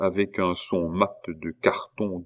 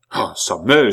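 loud exasperated outburst; exclaiming; 2 semitones higher, 8 dB louder than usual. A narrator's reading. Pitou answering the abbé's insults, crying out. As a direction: burst out in protest. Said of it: "s'écria Pitou".

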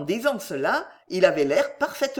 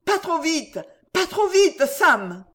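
high yelp, rising louder on the repeat; panicked plea; 9 semitones higher, 5 dB louder, slower than usual. A narrator's reading. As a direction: squeal to be slowed down. Skater panics while being pushed along.

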